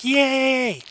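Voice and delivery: high, drawn-out mock cheer; imitated crowd cheer. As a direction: imitate a swept-up audience. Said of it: "everybody in the audience was just like".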